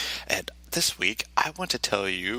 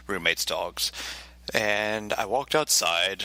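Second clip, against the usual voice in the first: ordinary talk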